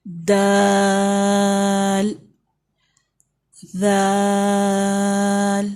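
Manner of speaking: letter names held long in a chant; drawn-out recitation. Shown as a demonstration. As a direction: chant each letter slowly. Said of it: "اس letter سے start کریں گے".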